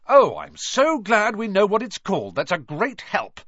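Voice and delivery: loud, sarcastic high snarl. Acted. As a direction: snap back with scorn. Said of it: "snarled Ron".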